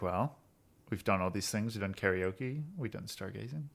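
soft, low acted musing; musing tally. A character reviews the summer's activities with friends, quiet musing. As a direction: quietly tick items off a list.

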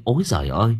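plain reading voice

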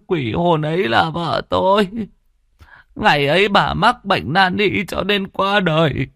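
high, drawn-out tearful lament; wailing grief. Acted. Old widower mourns his dead wife's suffering soul, wailing. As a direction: wail slowly, voice pitched high with sobbing grief.

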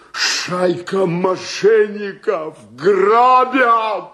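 slow, swelling, high shout; outraged cry. Acted. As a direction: raise the alarm, stretching each word. Slapped man cries out conspiracy. (возмущённый протяжный крик)

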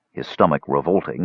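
ordinary delivery

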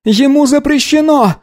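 very high insistent cry; boy's shout. Acted. Boy shouting back in quarrel.

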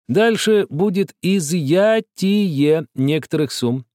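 slow and precise; clear, deliberate. A narrator's reading. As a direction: spell each word out. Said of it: "внятно ответил Бабель".

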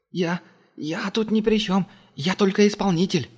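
cower and stammer; frightened stammer. A narrator's reading. Terrified technician.

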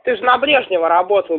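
normal talking voice

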